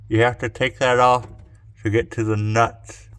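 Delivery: talking around a flashlight in the mouth. Real, not acted. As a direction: mouth full, flashlight held in teeth. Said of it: "I had a flashlight in my mouth".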